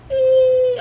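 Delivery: very high, loud electronic beep; shrill imitation of the monitor beep; machine beep. Imitating hospital machine beeping.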